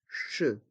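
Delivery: "che" as a soft SH sound; French "che", with no hard K. Shown as a demonstration. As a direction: say "che" as an isolated "sh". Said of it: "Le C et le H se prononcent".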